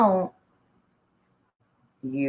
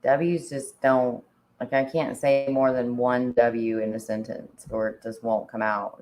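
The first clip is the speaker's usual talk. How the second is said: ordinary talk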